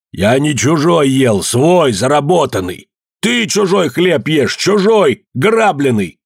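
heated, emphatic retort; angry shouting. A narrator's reading. Захар strains to outshout Евдоким, angry shouting. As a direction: throw the accusation straight back. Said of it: "кричал Захар".

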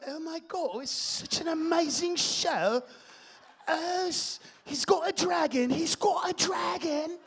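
put-on high, excited British accent. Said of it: "unless they spoke like this".

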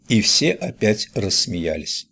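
plain reading voice

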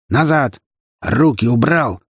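short, mildly shouted command. A narrator's reading. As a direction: order hands off. Cop ordering woman off the coffin. (приказ повышенным голосом)

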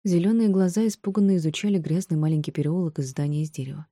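usual speaking voice

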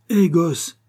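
appel plus haut et plus fort; héler le gamin d'une grosse voix bourrue; appel du brocanteur. Acted. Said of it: "fit-il". (le brocanteur hèle l'enfant, plus fort)

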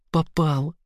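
squeaky high exclaim; high squeak. Acted. Girl squeaks behind him.

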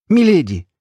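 high, loud cry of alarm; startled cry, pitched well up; exclaiming. A narrator's reading. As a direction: call out to her in shock. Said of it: "воскликнул Альт".

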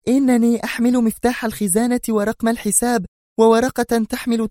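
shrieking resentment; indignant shout. A narrator's reading. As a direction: rage at the manager. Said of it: "صاحت في وجهه في حنق".

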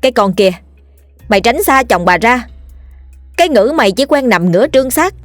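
furious screaming. A narrator's reading. Wife screaming at the mistress, enraged. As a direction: tear into the mistress.

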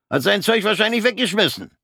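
high, loud gloating jeer; gleefully mocking. Corporal mocks Fritz to the sergeant, high and gloating. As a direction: crow over your own joke.

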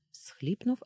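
ordinary delivery

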